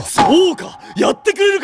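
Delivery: captain shouting with delight that his teammate agreed; thrilled shout. Acted. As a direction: burst out with joy. Captain thrilled teammate will perform.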